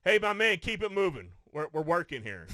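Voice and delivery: imitating a new worker loudly shooing away the director; loud brush-off. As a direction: bark it at the guy to get moving. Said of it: "He's like".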